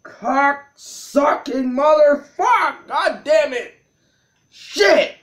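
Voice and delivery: high-pitched string of angry swearing; real exasperated outburst; furious cursing. Real, not acted. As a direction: spit out each curse in frustration. Player strings curses after loss.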